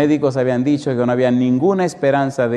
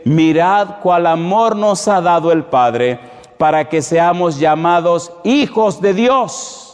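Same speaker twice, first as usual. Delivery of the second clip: loud, high, slow proclamation of a verse; preached scripture reading; 6 semitones higher, 4 dB louder, slower than usual. Acted. Preacher quotes the apostle John to the congregation, ringing declamation. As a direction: proclaim it to the congregation, drawing out the words. Reads scripture at full force.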